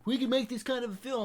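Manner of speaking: high put-on voice of an eager friend; Ronnie. Friend pitching a film idea, high imitated voice.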